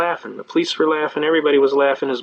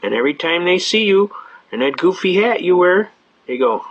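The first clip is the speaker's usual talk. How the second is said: ordinary talk, nothing marked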